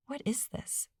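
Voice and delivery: half-breathed in surprise; startled hush. A narrator's reading. Startled heroine on finding piano.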